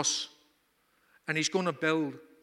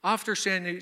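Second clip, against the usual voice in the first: ordinary delivery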